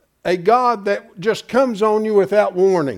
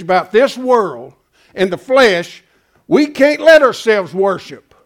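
preaching at full force; 4 dB louder than usual. Real, not acted. Preacher going off at his congregation, loud fiery sermon. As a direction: let it rip like a pulpit thunderer. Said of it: "a little off course on my diatribe there".

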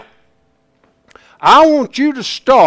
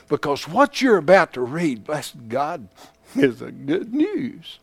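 quiet reading; 5 semitones lower, 9 dB quieter than usual. Shown as a demonstration. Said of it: "We should read this not with a monotone".

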